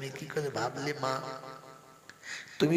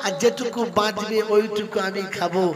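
much higher and louder impassioned cry; soaring preacher's wail; full-force preaching; 10 semitones higher, 12 dB louder than usual. Acted. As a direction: hurl it out to the whole crowd.

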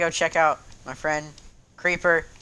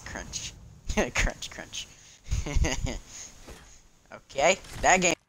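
normal talking voice

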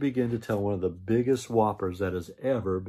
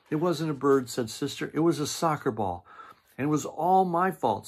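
eager cubs confessing; 6 semitones higher than usual. Acted. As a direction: blurt it out like guilty excited cubs.

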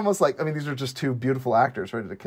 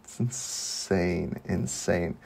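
low, slow, soft disbelief; hushed awe; 7 semitones lower, 6 dB quieter, much slower than usual. Real, not acted. Host recalls the throat-tearing scene, hushed awe. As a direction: draw it out low and quiet, awestruck.

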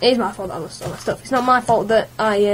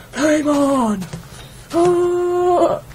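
high, hammed-up hero shout; mock superhero cry; 7 semitones higher, much slower than usual. Gamer jokes about Fantastic Four, high hammed-up hero shout. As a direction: call it out like the Human Torch.

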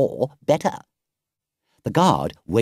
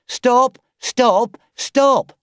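high, loud repeated shout; shouting stop; 12 semitones higher, 6 dB louder, much slower than usual. Acted. Driver mimicking the pursuers' cry to Toad, shouted. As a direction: bark each word like a chasing policeman. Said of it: "all shouting the same thing".

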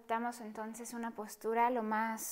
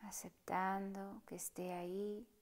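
soft, partly whispered meditation voice; hushed calm guide inviting stillness; guided meditation; 2 semitones lower, 10 dB quieter than usual. Acted. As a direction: guide them gently through the breath, unhurried. Leads a breathing meditation.

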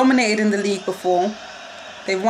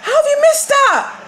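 anguished shout; slower than usual. Real, not acted. Fan watching Sterling miss a sitter, anguished cry. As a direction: scream as the sure goal goes wide. Striker misses open goal.

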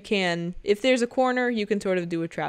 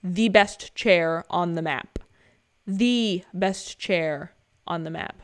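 slower and slower, drawn out; slow repeat; 3 semitones lower, slower than usual. Shown as a demonstration. Gamer echoing his favourite chair, slowing right down. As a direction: let the words stretch out slowly. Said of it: "repeated for emphasis".

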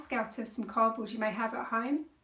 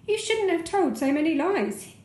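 a hint of stern grown-ups, higher; 7 semitones higher than usual. A narrator's reading. Grown-ups scolding the boy, lightly voiced stern. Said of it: "said the grown-ups sternly".

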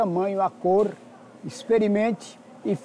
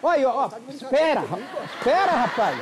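chamando a produção; 6 semitones higher than usual. Real, not acted. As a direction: call the crew for help, urgent. Chama a equipe por socorro. (gritando pela produção)

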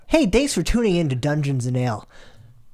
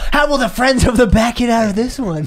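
TV teaser voice; 7 semitones higher, 3 dB louder than usual. Acted. Episode-end teaser voice.

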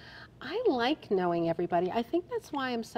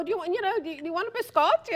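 high, loud, fast offering voice; pushy Italian host; 11 semitones higher, 3 dB louder, faster than usual. Retold or imitated. Italian host pressing food on a stranger, high and fast. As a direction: insist on the biscotti. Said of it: "feeding people".

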